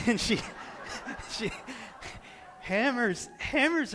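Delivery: talking through real laughter; laughing. Real, not acted. Husband telling how his wife fell, laughing helplessly. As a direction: break up laughing mid-sentence.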